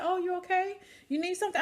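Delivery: a chirpy nurse checking in, voiced high. A nurse checks on the patient, imitated in a high voice. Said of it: "So one of the nurses said".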